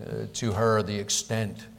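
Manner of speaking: usual speaking voice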